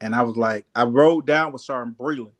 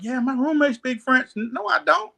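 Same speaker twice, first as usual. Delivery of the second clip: high pitched quoted back and forth; retelling a joke about speaking French; mock exchange; 11 semitones higher than usual. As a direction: voice the friend then deny it lightly. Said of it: "he was like".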